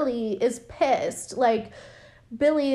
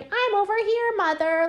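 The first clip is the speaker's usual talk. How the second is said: put-on high, childlike puppet reply; ventriloquist's puppet; 11 semitones higher than usual. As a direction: pipe up high as a puppet son. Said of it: "And Billy's like".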